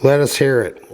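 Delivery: ordinary delivery, nothing marked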